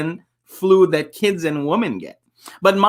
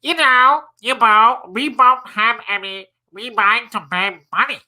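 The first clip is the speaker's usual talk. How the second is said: blocked nose voice; 4 semitones higher than usual. Acted.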